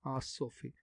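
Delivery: ordinary delivery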